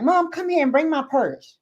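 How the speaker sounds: bossy. Retold or imitated. Daughter summons her mom to the office, bossy. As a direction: call mom over with mock bossiness. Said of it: "Why is she being so bossy?".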